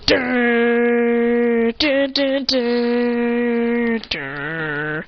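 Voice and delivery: long droned "durr" notes; imitated theme tune. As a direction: mouth a dramatic intro, dropping at the end. Imitating a dramatic opening sound.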